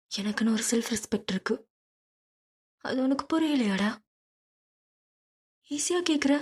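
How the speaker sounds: a proud woman pushing back, voice rising; hurt wife. Acted. Dhiya hurt and angry. (உயர்ந்த குரலில் காயப்பட்ட மனைவி)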